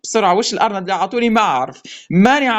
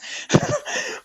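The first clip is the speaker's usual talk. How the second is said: high breathy laugh; breathless laugh; 7 dB quieter than usual. Real, not acted. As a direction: laugh out under your breath. Laughing after the luck.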